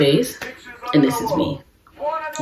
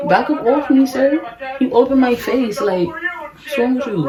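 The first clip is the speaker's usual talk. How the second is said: annoyed brush-off; 9 semitones higher than usual. Retold or imitated. Pushing away a clingy date.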